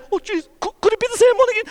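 high, startled cry of disbelief; startled villagers. Acted. Villagers at the forge door spot the returning rider: high startled cry.

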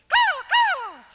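very high, loud crow squawk; piercing falsetto caws. Acted. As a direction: screech it out like a crow. Crow's cry inside children's song.

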